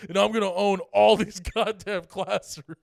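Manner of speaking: mock threat. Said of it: "he goes like".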